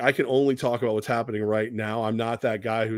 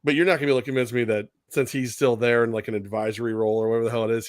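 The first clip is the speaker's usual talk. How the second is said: ordinary talk, nothing marked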